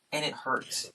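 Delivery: ordinary talk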